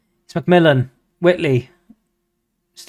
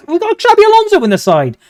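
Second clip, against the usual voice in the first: high, fast excited outburst; 4 dB louder, faster than usual. Real, not acted. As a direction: blurt it out, thrilled and high. Commentating own game.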